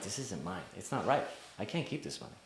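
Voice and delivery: hushed, low voice to himself; acted private doubt, soft and low; inner thought. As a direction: think it aloud, very softly. His private thought, retold.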